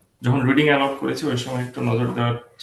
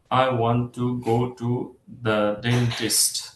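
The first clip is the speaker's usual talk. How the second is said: very slow, every word of "I want to go to the dentist" hit the same; robotic word-by-word even stress, shown as the wrong way; equal stress on every word; much slower than usual. Shown as a demonstration. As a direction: spell out each word evenly, no weak words. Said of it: "এইভাবে বলে না".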